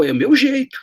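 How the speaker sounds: a hint of a careless boss; mock boss excuse. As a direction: shrug it off like the boss did. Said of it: "eu disse".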